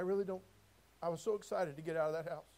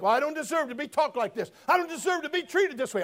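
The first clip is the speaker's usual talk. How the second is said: a touch of whiny complaint, mocking the listener; mock self-pity; 7 semitones higher, 8 dB louder than usual. Preacher mocking complainers in the pews, mock whine. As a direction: sulk it out as a mockery. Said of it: "that's what some of you think".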